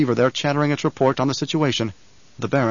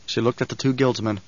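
usual speaking voice

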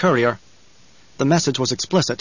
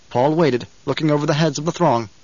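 plain reading voice

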